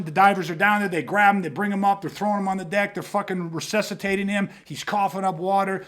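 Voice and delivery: ordinary delivery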